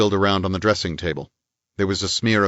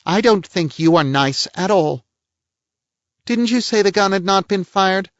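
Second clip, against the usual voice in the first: a higher-voiced prim society lady; lady's voice, pitched up and a little pert; voiced woman; 13 semitones higher, 4 dB louder than usual. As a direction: play the indignant lady of the house. Woman figure's lines.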